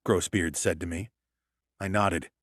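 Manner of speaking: usual speaking voice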